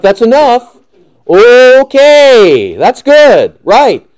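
high, loud, hammed-up exasperation; exasperated. Fed up with too much brotherly zeal, exasperated. Acted reply to smothering believer.

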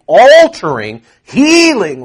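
preacher swelling to a cry; rising shout. Real, not acted. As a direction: build it up to a ringing shout. Building preacher climax.

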